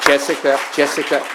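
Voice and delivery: loud call across the room. Real, not acted. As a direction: call the moderator's name to get her attention.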